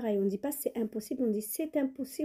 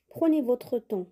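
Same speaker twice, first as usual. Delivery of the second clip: ordinary delivery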